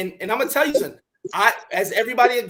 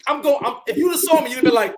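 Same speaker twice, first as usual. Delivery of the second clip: ordinary talk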